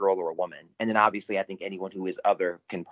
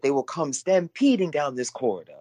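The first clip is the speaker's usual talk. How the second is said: higher, lightly put-on movie line; a hint of a dramatic British butler; quoting film character; 6 semitones higher than usual. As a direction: quote it with a touch of British flair. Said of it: "What is it?".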